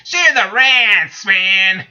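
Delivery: rally the viewers to rage along; real slow fired-up yell; angry shout. Real, not acted. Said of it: "Freaking angriness on my channel".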